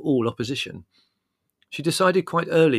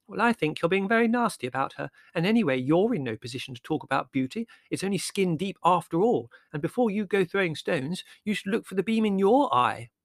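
put-on higher, pert girl voice scoring a point; Margot triumphant; 8 semitones higher than usual. A narrator's reading. Margot scolding Larry about beauty, triumphant and higher. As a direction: deliver the proverb as a triumph, pitched up. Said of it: "said Margot triumphantly".

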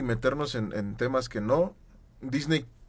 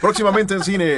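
loud, high movie trailer voice; 7 semitones higher, 8 dB louder than usual. Pretend. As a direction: boom it like a trailer announcer. Announcing a film release jokingly.

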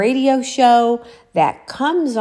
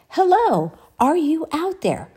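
much higher, searching radio call, imitated; imitated female voice; 9 semitones higher than usual. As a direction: mimic a woman calling out over radio. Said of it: "she said this female voice".

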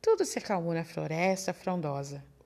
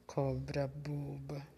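mouse whispers, mocking, under his breath; a sly whispered jeer to himself; whispered aside; 4 semitones lower, 9 dB quieter, slower than usual. Mouse mocking the snake behind its back, whispered.

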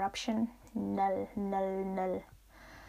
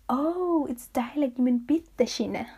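high, raised-voice "oh, I get it"; imitated penny-drop reaction, pitched up; dawning realization; 4 semitones higher, 5 dB louder than usual. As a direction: act out suddenly working out what was meant. Host family member acts out realizing.

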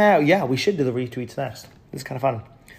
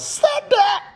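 much higher excited yell; hyper yell; 3 dB louder than usual. Retold or imitated. Streamer imitating his own hyper yell as the commenter describes it. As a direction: shout it like a hype man. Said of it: "acts hyper and yells".

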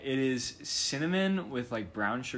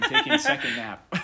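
laughing; 10 dB louder than usual. Real, not acted. Laughing self-joke.

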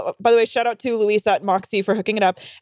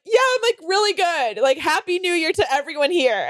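high, falsely upbeat reassurance; squeaky, hammed-up brave face; mock tearful cheer; 6 semitones higher than usual. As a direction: imitate insisting you're fine while upset. Tearful, pretending to be okay.

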